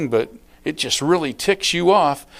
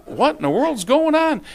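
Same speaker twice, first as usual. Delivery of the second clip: much higher-pitched acted disbelief; mock astonishment; bewildered; 12 semitones higher than usual. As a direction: exclaim it, baffled by the fuss. Bewildered reaction to acted quarrel.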